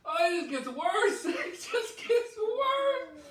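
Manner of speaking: high strained laughing cry; pained laughing. Real, not acted. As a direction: laugh through the burn. Sauce heat building, laughing.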